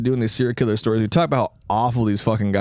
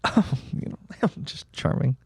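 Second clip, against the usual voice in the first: smug charmer; 2 semitones higher, 6 dB quieter than usual. Retold or imitated. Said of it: "they're like".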